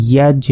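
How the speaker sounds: ইয়াজ with a bounced final j; echoing qalqalah. Shown as a demonstration. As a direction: release the j of ইয়াজ with a short echo. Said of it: "কলকলা করে পড়তে হয়".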